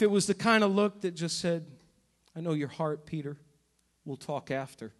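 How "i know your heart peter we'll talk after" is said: soft, low, gentle and knowing; tender knowing voice. Retold or imitated. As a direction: reassure him quietly, no reproach. Imagined tender look of Jesus.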